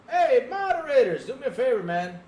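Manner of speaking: loud, high shout to get attention; calling out. Real, not acted. Streamer hailing the moderators over a troll, calling out.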